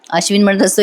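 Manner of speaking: ordinary talk, nothing marked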